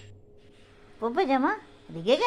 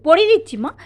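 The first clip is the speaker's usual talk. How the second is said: high and louder, urgent reassurance; pleading call; 8 semitones higher, 10 dB louder, faster than usual. Real, not acted. Parent to a toddler fighting her dress, loud pleading. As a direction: raise the voice to cut through the fuss.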